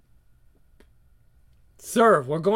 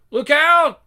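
ordinary delivery

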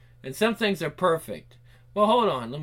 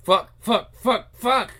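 panicked swearing; 5 semitones higher, 5 dB louder, slower than usual. Acted. Panicking after slamming door.

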